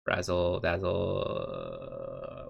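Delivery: very slow, playful drawl; slow razzle dazzle; drawn-out sing-song. Pretend. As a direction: stretch it out like a magic trick. Drawn out silly phrase.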